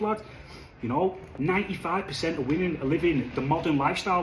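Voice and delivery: normal talking voice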